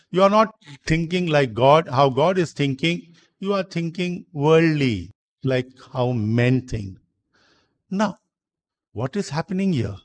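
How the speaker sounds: ordinary delivery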